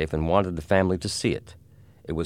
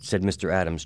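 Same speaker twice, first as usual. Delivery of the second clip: ordinary delivery, nothing marked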